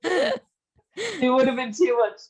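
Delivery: laughing through the words; slowed, giggling delivery. Real, not acted. Laughing while telling story.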